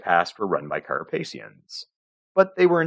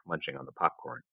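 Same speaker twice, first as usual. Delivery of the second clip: ordinary delivery, nothing marked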